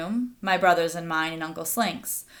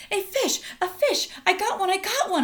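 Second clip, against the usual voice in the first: put-on high, much louder thrilled child's shout; excited yell; 12 semitones higher, 3 dB louder than usual. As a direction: shout the catch to everyone. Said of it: "I yelled".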